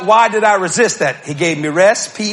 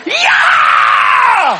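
very high, loud cheering shout; excited yell; 5 dB louder than usual. Retold or imitated. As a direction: jump up and cheer. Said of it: "He's excited".

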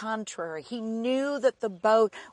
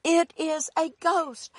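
mild frightened cry; 7 semitones higher than usual. Acted. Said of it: "they cried out".

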